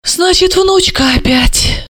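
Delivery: slow, high, disappointed sigh of words; deflated. A narrator's reading. Mother learning of another granddaughter, crestfallen. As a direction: let it wilt slowly at the end. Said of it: "окончательно сникла Альбина Яновна".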